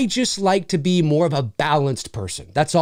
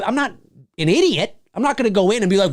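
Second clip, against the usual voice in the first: ordinary delivery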